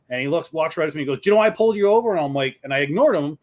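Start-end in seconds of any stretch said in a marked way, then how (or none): 1.2-2.1 higher, louder retold cop demand; pissed-off cop. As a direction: imitate an angry cop confronting a driver. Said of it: "pissed off".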